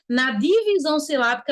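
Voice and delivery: ordinary talk, nothing marked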